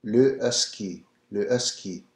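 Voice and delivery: Husky with a soft, barely breathed H; French Husky. Shown as a demonstration. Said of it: "the H is pronounced a little bit softer".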